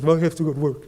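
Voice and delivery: mock teen sulk in a put-on voice; pouty teenage daughter. Daughter answers dad in the kitchen, pouty. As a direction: whine like a put-upon teenager. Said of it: "she kinda had a pouty look".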